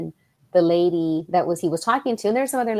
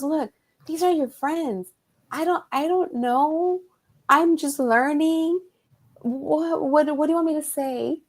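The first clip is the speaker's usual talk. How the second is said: imitating boyfriend; 8 semitones higher than usual. Boyfriend asking Claudia what to say, higher helpless voice. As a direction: play the clueless boyfriend asking for help.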